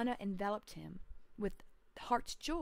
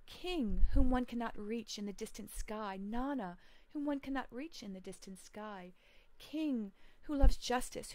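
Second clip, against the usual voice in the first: solemn prayer reading; 3 semitones higher, 5 dB louder than usual. Acted. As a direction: pray to the sun god as a lost, grieving man. Lugalbanda prays to Nana.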